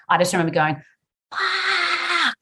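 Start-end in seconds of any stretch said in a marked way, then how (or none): 1.3-2.4 shouted high curse; frustrated swear. Retold or imitated. Mother hearing the palate news, a sudden frustrated shouted curse. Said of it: "And I swore".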